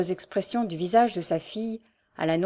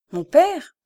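startled, high and loud exclamation; trembling cry; 8 semitones higher, 8 dB louder than usual. Acted. Diane hearing her father at the door, trembling cry. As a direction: cry out in alarm. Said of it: "s'écria Diane toute tremblante".

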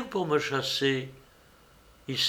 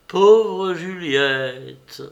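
slow, louder, higher anguished cry; breaking into tears; 5 semitones higher, 7 dB louder, much slower than usual. A narrator's reading. Léonie weeps over Juliette, slow and loud. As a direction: let the grief break out. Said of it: "il versa un torrent de larmes".

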